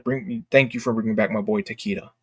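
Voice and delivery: ordinary delivery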